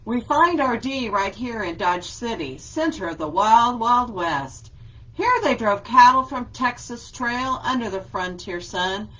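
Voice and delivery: lively read-aloud with a steady rhyme beat; reading to children. Acted. Teacher reads an alphabet book to her class, lively and rhythmic.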